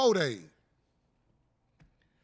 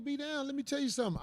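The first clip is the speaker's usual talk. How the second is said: normal talking voice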